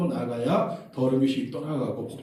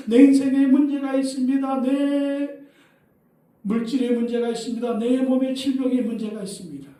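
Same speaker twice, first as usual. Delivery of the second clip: loud, high sustained cry to the Lord; wailing prayer at full voice; 10 semitones higher, 6 dB louder than usual. Acted. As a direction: shout your desperate plea on a high held pitch. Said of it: "예수님께 나와서 소리 질러야".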